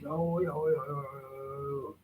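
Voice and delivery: high, loud string of no; real alarmed no. Real, not acted. Owner yells at the dog getting close to the sword, high and loud. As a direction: stop the dog before she reaches the sword. Stopping Barb near the sword.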